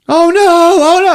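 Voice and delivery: high, loud mock panic; hammed-up high-pitched alarm; panicked cries. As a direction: cry out like a panicking crowd. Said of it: "Everybody starts panicking".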